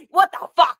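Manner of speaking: high, loud mock outburst; acted exasperated shout; imitated angry swearing. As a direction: swear like a yelling superior. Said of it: "that would be the normal talk".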